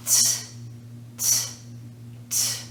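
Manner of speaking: crisp voiceless "t", no vowel after it; isolated T sound. Shown as a demonstration. Teacher showing learners the T sound, isolated voiceless "t". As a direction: say "t" as a bare burst of air, spaced apart. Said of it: "also has no vibration".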